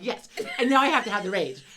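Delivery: high, loud mock fury with a laugh in it; worked-up comic outrage; retold rage. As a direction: insist on your right to be mad. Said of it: "Now I'm fucking mad".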